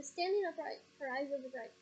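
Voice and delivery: ordinary delivery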